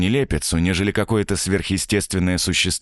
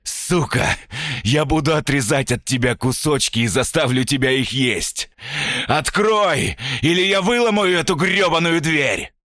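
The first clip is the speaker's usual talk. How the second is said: furious shout; 9 semitones higher than usual. Acted. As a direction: bellow threats at the door. Stranger raging at the door.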